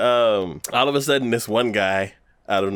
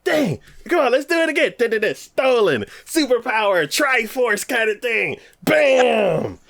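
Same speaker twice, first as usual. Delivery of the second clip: high, loud mock pep talk; hammed-up team cheer; 13 semitones higher, 3 dB louder than usual. Retelling the anime team rallying, high mock pep talk. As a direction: play the eager kid rallying the team. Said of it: "They're like".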